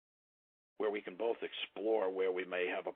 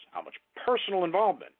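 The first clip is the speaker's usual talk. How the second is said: "personal involvement" lifted high and louder, quicker pace; inflection on PERSONAL INVOLVEMENT; 9 semitones higher, 5 dB louder, faster than usual. Shown as a demonstration. Sales trainer demonstrating a prospect question, emphatic inflection on "personal involvement". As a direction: punch up "personal involvement" with raised pitch and volume. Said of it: "There's the voice inflection again".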